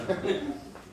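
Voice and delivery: a quick high laugh rising at the end; short amused laugh, going up. Real, not acted. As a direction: laugh at your own story. Laughing at own story.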